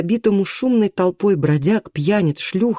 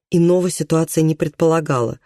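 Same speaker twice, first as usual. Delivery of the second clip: ordinary delivery, nothing marked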